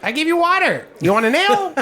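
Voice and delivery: high, chirpy, fast-talking gnome innkeeper; Gilly the gnome. Acted. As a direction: gush over the heroes like a showman gnome. GM voices Gilly.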